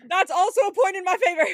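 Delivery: loud, high, gleeful laughing claim; laughing triumph. Real, not acted. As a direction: claim the point loudly through laughter.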